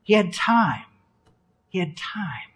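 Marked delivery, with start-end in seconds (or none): 1.7-2.5 very soft whisper; barely voiced, for emphasis; whispered repetition. Real, not acted. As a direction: hush it to let it sink in. Point repeated in a whisper.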